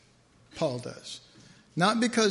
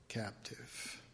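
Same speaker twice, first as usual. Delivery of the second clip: hushed; 5 semitones lower, 14 dB quieter, slower than usual. Real, not acted. Closing point of sermon.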